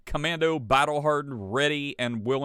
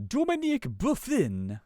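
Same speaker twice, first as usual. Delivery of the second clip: high-pitched mock French accent; bad French accent; 10 semitones higher than usual. Shown as a demonstration. Host trying a French name, bad French accent. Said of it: "I can't do a French accent".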